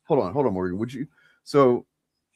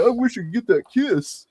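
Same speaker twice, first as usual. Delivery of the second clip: high, pinched mock voice of a pining fan; giggly high parody of a lovesick character; mock lovestruck comedian; 11 semitones higher, 4 dB louder than usual. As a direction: lampoon the writer's wishful sigh. Mocking the comedian's inner voice.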